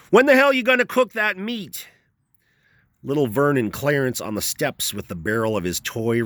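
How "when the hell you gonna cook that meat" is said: much higher, loud angry shout; sudden shout. A narrator's reading. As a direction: bark it out of nowhere, impatient. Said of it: "he suddenly shouted at Greg".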